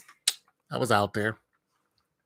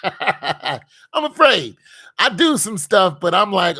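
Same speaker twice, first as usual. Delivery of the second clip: slow, high, louder through a laugh; 7 semitones higher, 8 dB louder, slower than usual. Shown as a demonstration. Admitting his fear of God with a laugh. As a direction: laugh it out slowly. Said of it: "I'm afraid of God".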